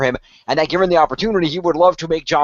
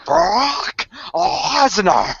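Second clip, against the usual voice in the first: very slow, much higher, "Brock Lesnar" stretched almost into a wail; Heyman saying the name; 10 semitones higher, much slower than usual. Retold or imitated. Brock asks Heyman to repeat it, drawn-out wail. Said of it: "say it again".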